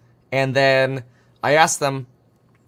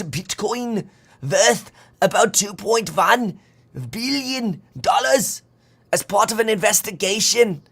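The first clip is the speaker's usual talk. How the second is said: high-pitched, mock German accent; annoying German voice; 9 semitones higher than usual. Streamer hamming up a German police news item, annoying German accent. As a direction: shout it out in an over-the-top German accent. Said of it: "Sorry, that's my annoying German voice".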